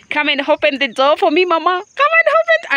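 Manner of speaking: high, loud, fast call to open up; pleading high shout to Mama. Pretend. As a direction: yell up at the house to open the door. Calling to open door.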